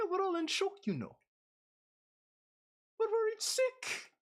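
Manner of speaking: a dramatic classmate in shock; high classmate voice. Acted. Voices a high-pitched male classmate.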